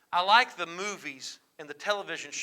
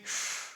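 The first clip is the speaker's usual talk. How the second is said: demonstrated exasperated puff of breath; reaction sigh; 5 dB quieter than usual. Shown as a demonstration. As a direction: blow out a sigh as a first reaction. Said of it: "Your reaction may be".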